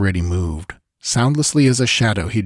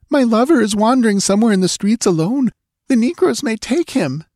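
higher and fast, worried rush; anxious girl; 14 semitones higher than usual. A narrator's reading. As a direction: rattle it off in panic, pitched up. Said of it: "almost hysterical again".

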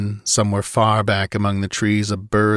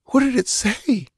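put-on much higher girl's voice, hushed; frightened girl's voice. A narrator's reading. Olivia asks Conan about the parrot, uneasily. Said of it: "she whispered".

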